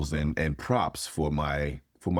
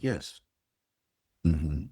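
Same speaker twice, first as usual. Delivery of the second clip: mild mock-clinical therapist questioning. Intern therapist asking a client about feelings, mock clinical. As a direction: lightly imitate a measured, textbook counselor. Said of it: "I went and I was clinical".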